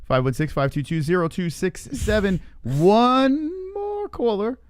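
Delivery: a cheesy late-night psychic taking calls; mystic hotline host. Acted.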